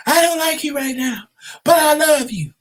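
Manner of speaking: high, hammed-up dramatic declaration; movie-scene line. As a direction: deliver it like a soap opera. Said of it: "Oh, this a movie scene".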